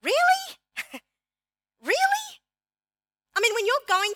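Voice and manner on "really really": much higher, disbelieving Really with a laugh between; incredulous really. Retold or imitated. Preacher reacting to a friend's letter, incredulous and much higher. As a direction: sound amazed at her friend's attitude. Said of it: "I'm like".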